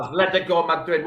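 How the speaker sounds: teasing imitation of a Spanish accent; put-on Spanish accent, poking fun; mock Spanish accent. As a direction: mimic his Spanish-accented club name. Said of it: "say it in Spanish accent".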